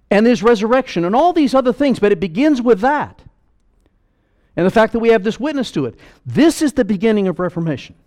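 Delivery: loud, high preaching voice. Acted. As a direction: preach it out with full force. Preaching at full force.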